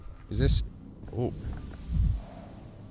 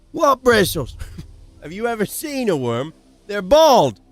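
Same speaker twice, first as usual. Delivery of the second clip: voiced child; 7 semitones higher, 5 dB louder than usual. Voicing the kid character.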